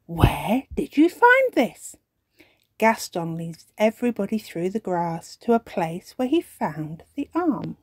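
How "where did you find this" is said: curious. Acted. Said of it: "looking at the object curiously".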